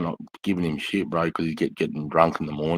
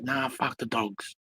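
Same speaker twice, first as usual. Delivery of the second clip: defiant mate; 8 semitones higher, 5 dB quieter, slower than usual. Retold or imitated. Mate's defiant answer retold.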